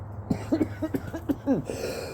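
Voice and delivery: hacking smoker's cough; ragged coughing fit; harsh cough. Real, not acted. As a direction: cough it out hard.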